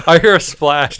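ordinary delivery

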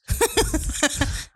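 high, loud gleeful cackle; mischievous laugh. Real, not acted. Cackling at her own idea of egging mailboxes.

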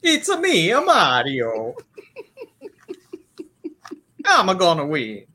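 high-starting, cartoon video-game Italian accent. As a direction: shout it in a bouncy mock Italian accent. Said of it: "I have the Italian accent".

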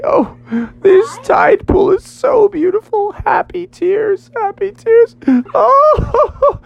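high, wailing mock sobs; hammed-up tearful awe; happy crying. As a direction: blubber with joy in a squeaky high voice. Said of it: "they began to cry".

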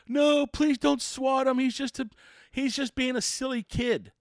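high, slow whiny plea; mock pleading. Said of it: "they tried to say".

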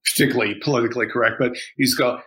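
ordinary talk, nothing marked

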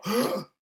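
a sudden high, startled gasp; sharp gasp. Retold or imitated. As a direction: suck in air as if hit. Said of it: "I hit the railing and I go".

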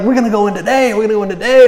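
raring to charge in; excited. Retold or imitated. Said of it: "they're so excited".

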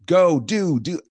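high, loud barked parent command; bossy parent order. Retold or imitated. Parent barking orders at a child, high and loud. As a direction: snap it out like a pushy parent.

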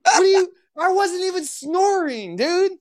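very high, squealing disbelief; indignant protest. Real, not acted. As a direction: protest it in a high-pitched outraged cry. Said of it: "that made me so mad".

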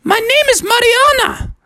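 very high falsetto creature voice; high, magical narwhal voice; lead narwhal. Acted. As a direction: introduce yourself as a squeaky sea creature. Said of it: "narwhal popped up and said".

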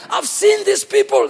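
high, indignant declaration; angry God. Acted. God complains to Moses about his people, angry. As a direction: pronounce it as an accusation. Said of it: "God is angry".

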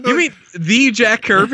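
high, loud, rising "the Jack Kirby?"; light up as if the penny just dropped; imitating a friend's sudden awe; amazed realization. Friend finally recognises the name.

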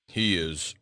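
low, loud growl, cut off; growling goat. A narrator's reading. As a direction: growl it, then get cut off. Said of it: "growled Bilbil".

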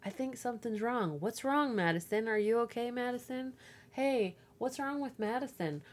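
high, insistent prying voice; imitating a classmate who won't stop asking; mock nosy kid. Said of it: "she's like".

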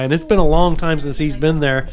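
ordinary talk, nothing marked

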